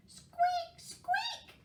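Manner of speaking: very high tiny squeak; high mouse voice for children; squeaky mouse. A narrator's reading. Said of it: "said Mouse".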